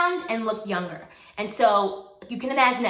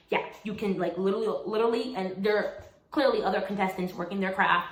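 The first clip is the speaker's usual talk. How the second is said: usual speaking voice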